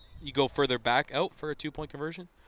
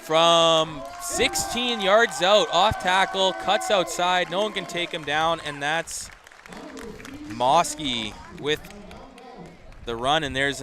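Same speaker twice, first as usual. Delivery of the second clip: excited play-by-play; 5 semitones higher, 6 dB louder than usual. Real, not acted. Live call of a touchdown run.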